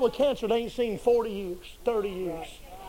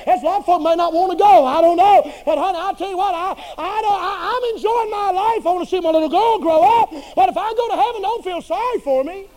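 fervent preaching; 6 semitones higher, 5 dB louder than usual. Acted. Preaching at full force, rising.

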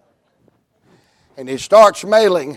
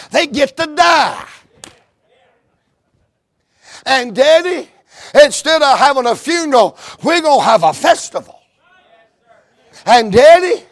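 preaching at full force; 7 semitones higher, 5 dB louder than usual. Acted. Preacher telling the congregation they get to live, full force. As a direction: proclaim it like a triumphant sermon.

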